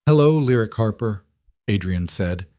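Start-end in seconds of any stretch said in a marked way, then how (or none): 0.1-1.2 tearful, voice cracking; voice breaking. A narrator's reading. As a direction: choke up as you say it. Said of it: "his voice breaking completely now".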